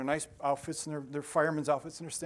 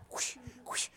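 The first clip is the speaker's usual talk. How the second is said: soft, airy whooshing effect; imitated whoosh; 8 semitones higher, 7 dB quieter than usual. Imagined hero swooping to the rescue, soft whoosh noises. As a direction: blow out two quick whooshes.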